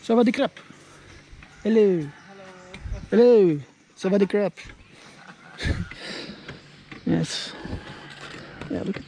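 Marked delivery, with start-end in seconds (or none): none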